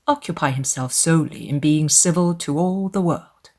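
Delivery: plain reading voice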